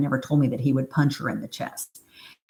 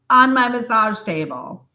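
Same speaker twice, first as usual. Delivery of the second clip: ordinary delivery, nothing marked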